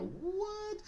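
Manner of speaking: very high, drawn-out what; hammed-up incredulous reaction; mock disbelief. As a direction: squeak it out in exaggerated surprise. Viewer's stunned reaction acted out.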